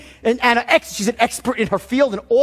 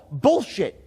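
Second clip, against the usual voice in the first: normal talking voice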